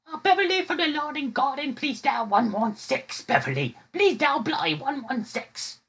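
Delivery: very high, slow, drawn-out falsetto page; hammed-up British lady on the store PA; mock British paging voice. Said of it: "so I would page her like this".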